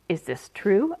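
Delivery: suspicious rat voice. A narrator's reading. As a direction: ask it with suspicion. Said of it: "eyeing the sac suspiciously".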